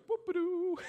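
high squeaky cheeky whoop-a-doo; mocking whoop. Class clown mocking the teacher who fell, cheeky high jeer. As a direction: tease her with a giddy high jeer. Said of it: "an idiot named Matt Skifstad went".

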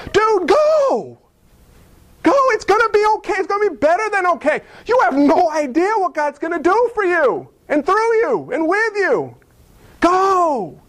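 high, fast, eager urging; excited coach to Abraham; urgent cheering on. Pretend. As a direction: push a scared friend out the door. Urging Abraham across time.

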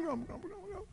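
very soft muttered grumble; mock grumbling. Preacher undercutting the happy greeting, grumbling. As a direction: mumble it under your breath.

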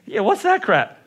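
mock Pittsburgh accent, laughing; lightly imitating a Pittsburgh local. Pastor mocking Pittsburgh folks, laughing Pittsburgh accent. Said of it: "what do they say".

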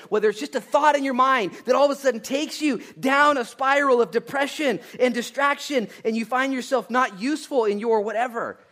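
high, loud, driving sermon build; preaching at full force. Real, not acted. Preacher warning congregation of spiraling thoughts, full force. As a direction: pour it out loudly to the congregation.

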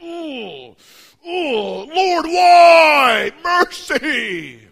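high, loud crying plea; devastated wail. Retold or imitated. Heartbroken leader wails to the Lord in worship. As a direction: cry out in anguish to God. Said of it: "he was devastated".

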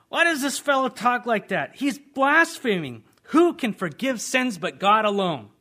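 high, loud, scandalised voice; indignant teachers of the law. Acted. Teachers of the law muttering against Jesus, high and outraged. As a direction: sneer it out in outrage.

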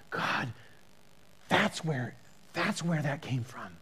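soft, low murmur of wonder; hushed awe. Acted. Preacher voices someone praying at a sunset, hushed awe. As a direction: say it softly, halting in amazement.

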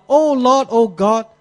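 high, loud pleading cry; crying out to God. Acted. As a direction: call out to the Lord at full voice. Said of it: "he cried out to the Lord".

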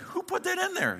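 high squeak of mock surprise, then back down; mock surprised squeak. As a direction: play it as a startled hungry shopper. Talking to his hungry cart.

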